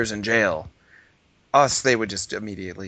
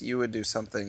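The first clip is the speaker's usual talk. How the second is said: usual speaking voice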